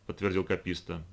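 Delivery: plain reading voice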